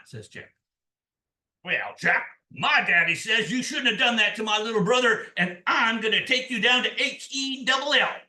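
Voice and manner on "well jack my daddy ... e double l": loud, higher-pitched demon threat; medium-sized demon. A narrator's reading. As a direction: lay down the threat as a mid-sized devil. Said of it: "a medium tall demon there".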